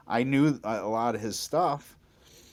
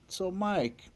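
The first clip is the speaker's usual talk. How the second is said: imitated Texas drawl, pitched up high; 9 semitones higher, 3 dB quieter than usual. Hero turns to the bass player at sound check, imitated Texas drawl. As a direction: lightly put on a high Texan voice. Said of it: "in his Texas drawl".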